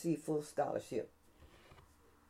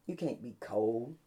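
usual speaking voice

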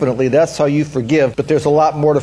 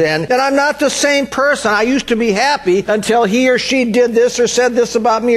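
whiny complainer; 7 semitones higher than usual. Retold or imitated. Imitating bitter complainer.

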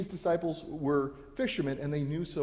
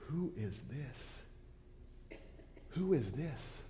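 softer, slow, wondering "Who is this?"; hushed question; 10 dB quieter, much slower than usual. Real, not acted. Pastor asking the congregation, hushed and awed. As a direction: let the question hang in awe.

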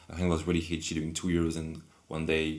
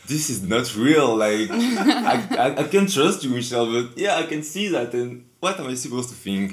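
amazed disbelief; 6 semitones higher, 9 dB louder than usual. Retold or imitated. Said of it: "I was like".

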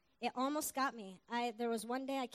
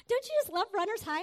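a quick, higher, brighter imitation of the friend's enthusiasm; gushing friend; 7 semitones higher, 6 dB louder, faster than usual. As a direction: mimic a friend raving about running, lightly hammed up. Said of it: "she's like".

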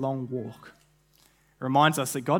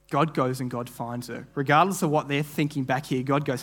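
ordinary talk

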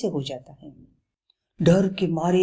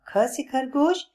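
higher, clear teacher voice; teaching; 9 semitones higher than usual. Shown as a demonstration. Bablu teaches the next letter, higher. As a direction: announce the next letter brightly. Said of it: "teaching next letter".

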